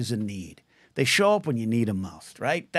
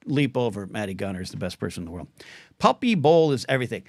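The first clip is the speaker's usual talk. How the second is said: ordinary talk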